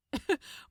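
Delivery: short amused chuckle; a smug little laugh. Acted. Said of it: "he chuckled".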